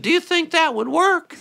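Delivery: put-on high, small child's voice; a little boy asking eagerly; little brother's voice. Little brother asks his big brother, high child's voice. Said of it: "He said".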